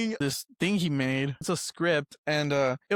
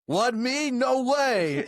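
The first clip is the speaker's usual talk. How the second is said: put-on Jersey accent, higher pitched; higher, hammed-up Jersey voice; 4 semitones higher, much slower than usual. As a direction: redo it in a pushed-up Jersey accent. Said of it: "do it in a Jersey accent".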